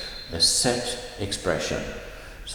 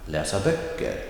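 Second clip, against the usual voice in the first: läsa unstressed, stress on böcker; phrase stress; 4 semitones lower than usual. Shown as a demonstration. Teacher repeating the example for students. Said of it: "One more time".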